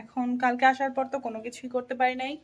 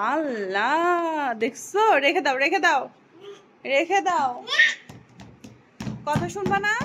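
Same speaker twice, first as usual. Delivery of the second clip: loud, higher put it down; exasperated scolding; 4 semitones higher, 4 dB louder, slower than usual. Real, not acted. Mother to toddler spilling powder, loud exasperated scold. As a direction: stop her before the mess spreads.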